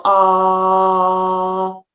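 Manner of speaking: plain sustained ah vowel. Shown as a demonstration. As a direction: hold a relaxed open ah. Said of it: "let's say ah".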